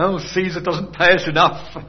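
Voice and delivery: a light touch of a grumbling soldier; mock-complain as a soldier about pay. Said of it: "sitting around grumbling about".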